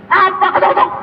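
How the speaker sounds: very high-pitched indignant cry; mock yell. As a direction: yelp it at the one knocking. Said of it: "dia akan teriak lagi".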